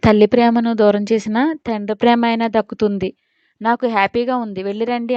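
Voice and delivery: ordinary delivery, nothing marked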